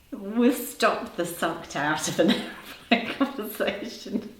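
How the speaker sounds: words breaking into giggles; trying to talk through the laughter; laughing. Real, not acted. Host giggling at her own slip, laughing.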